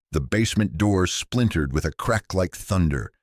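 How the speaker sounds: ordinary delivery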